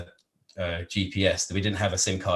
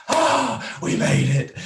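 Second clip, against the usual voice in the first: relieved cry; 4 dB louder, much slower than usual. Retold or imitated. Reaching the highway at night.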